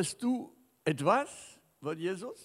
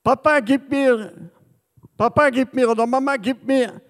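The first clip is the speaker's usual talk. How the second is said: put-on higher, louder child's whine; children pestering their parents, whining; begging child; 5 semitones higher, 5 dB louder than usual. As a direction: beg like a small kid. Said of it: "zu betteln".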